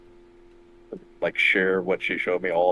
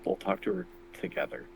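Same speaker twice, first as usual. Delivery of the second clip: hushed, soft, much quieter; talking softly; 11 semitones higher, 11 dB quieter than usual. Acted. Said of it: "I'm trying to talk softly".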